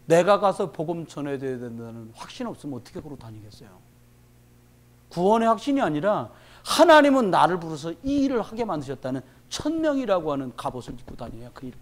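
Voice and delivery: ordinary talk, nothing marked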